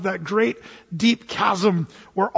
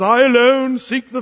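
higher-pitched theatrical British declamation; British accent; 3 semitones higher, 6 dB louder than usual. Acted. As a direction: proclaim it like a British stage actor. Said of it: "gave him a British accent".

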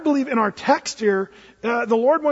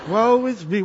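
mock woe, accent slipping. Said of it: "What happened to his British accent?".

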